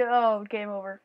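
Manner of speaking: ordinary delivery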